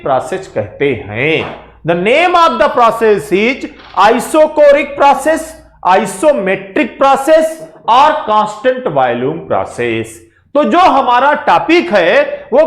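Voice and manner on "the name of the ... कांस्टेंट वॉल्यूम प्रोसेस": names drawn out very slowly for students; slow naming. Shown as a demonstration. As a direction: dwell on each name so it sticks. Teacher stressing the process names. (नाम धीरे-धीरे ज़ोर देकर)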